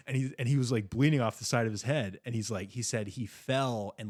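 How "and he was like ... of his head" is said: normal talking voice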